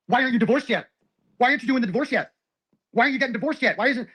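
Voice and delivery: high-pitched mock pestering; imitated nagging. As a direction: mimic someone badgering with the same question. Imitates her repeated divorce nagging.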